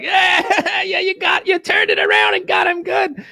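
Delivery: high, squealed mock cheering; jeering crew. Whole crew cheering the comeback, high rowdy jeering. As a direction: whoop it up like a delighted gang. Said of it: "And everybody like".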